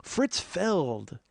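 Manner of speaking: ordinary delivery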